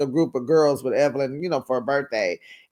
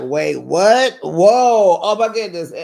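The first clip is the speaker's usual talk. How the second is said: imitating everyone's loud stunned reaction; shocked; 2 semitones higher, 7 dB louder, slower than usual. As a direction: react in disbelief. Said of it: "everybody was like".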